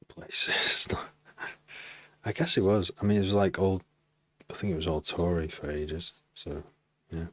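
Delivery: ordinary talk, nothing marked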